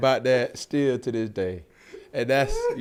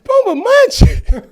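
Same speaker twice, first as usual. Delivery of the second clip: high, loud, slow drawn-out name; nickname shout; 13 dB louder, slower than usual. Acted. Dad crowning his son's nickname, loud and slow.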